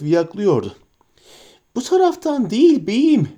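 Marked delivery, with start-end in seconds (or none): 1.7-3.3 much higher pleading creature voice; begging. Acted. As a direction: beg at your master's feet. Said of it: "diye yalvardı".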